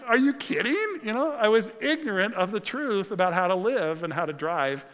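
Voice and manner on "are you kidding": incredulous. Retold or imitated. Retold disbelief at the ticket.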